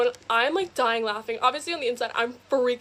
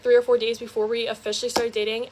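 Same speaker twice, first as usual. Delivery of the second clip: normal talking voice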